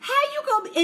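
high, squeaky outraged question; outraged disbelief. Retold or imitated. Vlogger yelling at Drew on screen in high disbelief. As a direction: act scandalized at the TV. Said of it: "I was like".

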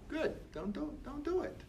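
high, soft mock naysayer voice. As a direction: parody a whiny doubter. Mimicking discouraging commenters.

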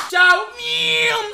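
loud, high zoom-off noise; whooshing getaway. Retold or imitated. Streamer fleeing to save his life, high whooshing goodbye. Retold panicked dash away.

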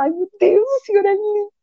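high, rapt cry of thanks to God; overjoyed praise. Retold or imitated. New mother sees healthy baby, high overjoyed praise. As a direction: gush with wonder and relief.

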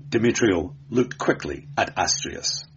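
usual speaking voice

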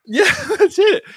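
real laughter breaking through the words; laughing. Real, not acted. Laughing at friend's joke.

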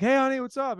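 high-pitched imitated wife; wife's greeting. Wife greeting the actor coming home, high mock voice. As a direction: chirp it high like a wife saying hello.

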